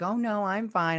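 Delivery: high-pitched imitated brush-off; mock reassurance. Wanda answers the offer of help, mock airy reassurance.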